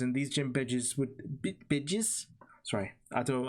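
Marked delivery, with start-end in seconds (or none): 0.0-2.3 slipping into a New Zealand accent; New Zealand accent on badges. Real, not acted. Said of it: "a New Zealand accent came out of me there".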